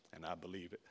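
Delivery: low, very soft, sincere; hushed admission. Retold or imitated. Boss admits he believes it, hushed and sincere. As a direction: drop right down for the confession. Boss's heartfelt admission, retold.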